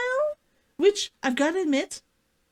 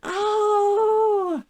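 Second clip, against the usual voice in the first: loud high oh; excited shout; 5 semitones higher, 5 dB louder than usual. Real, not acted. Calling out to viewers, delighted shout.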